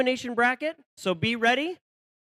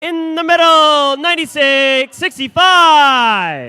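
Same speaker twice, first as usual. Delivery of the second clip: arena announcer; 3 semitones higher, 12 dB louder, much slower than usual. Acted. Announcing team numbers.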